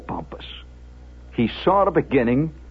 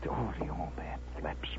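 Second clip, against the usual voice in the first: hushed, voiceless narration; awed whisper; 12 semitones lower, 18 dB quieter than usual. A narrator's reading. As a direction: whisper it in dread of what is coming. Hushed awe as father snaps.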